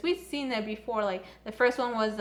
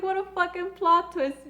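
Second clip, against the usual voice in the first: higher, amazed exclamation; excited shock; 7 semitones higher, 3 dB louder than usual. Retold or imitated. Fan realizing Yuri was the playboy, higher amazed outburst. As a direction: blurt it out amazed. Reacting to the twist.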